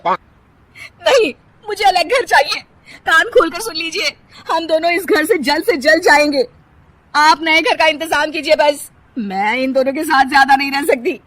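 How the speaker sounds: loud, high, crying insistence; tearful angry demand. Acted. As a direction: demand a new house, near tears. Kirti demands separate home. (रोते-गुस्साते अलग घर की ज़िद)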